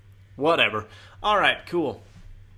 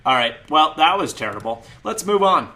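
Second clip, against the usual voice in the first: loud; 5 semitones higher, 9 dB louder than usual. Real, not acted. Snaps out of whisper loudly.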